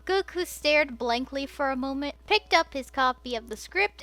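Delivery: ordinary delivery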